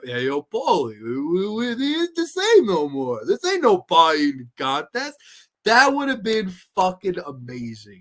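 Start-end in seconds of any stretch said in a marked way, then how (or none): 0.0-5.2 Stallone. Retold or imitated. Imagining him calling to Paulie, slow swooping impression. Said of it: "imagine somehow getting Burt Young".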